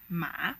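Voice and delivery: "ma" pressed down low, then bounced up; third tone. Shown as a demonstration. Teacher demonstrating the hardest tone, "ma" dipping then rising. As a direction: dip deep on "ma" and rise out of it. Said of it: "press your voice down and then bounce it back".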